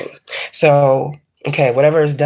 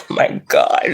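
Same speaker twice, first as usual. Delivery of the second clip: usual speaking voice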